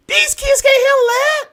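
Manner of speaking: very high, loud protest; impassioned shout. Real, not acted. Preacher arguing kids differ from his generation, impassioned high shout. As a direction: holler it in exasperated disbelief. Heated argument to the panel.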